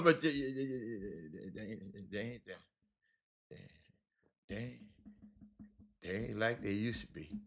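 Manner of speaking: soft reluctant grumble; trailing off softly. Real, not acted. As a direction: let it die away reluctantly.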